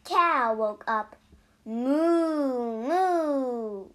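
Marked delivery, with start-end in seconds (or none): 1.6-3.9 long, drawn-out moos; cow mooing. A narrator's reading. Cow just woken up in a story, long mooing. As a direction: moo slowly like a storybook cow. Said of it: "said Cow".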